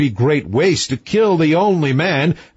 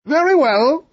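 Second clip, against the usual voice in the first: raised, lordly king voice; high-voiced storybook king; king's voice; 13 semitones higher than usual. A narrator's reading. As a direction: pitch the king up, grand and grudging. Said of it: "said the king".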